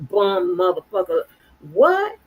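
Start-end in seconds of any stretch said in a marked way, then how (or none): 1.7-2.2 much higher, rising what; challenging what. Real, not acted. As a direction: fling it out defiantly. Defiant challenge.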